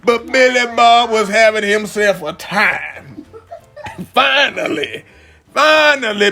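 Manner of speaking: high, excited tale of the bear ride; excited storytelling. Acted. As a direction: tell the chaos all wound up. Excited high narration of chaos.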